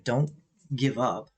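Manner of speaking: ordinary talk, nothing marked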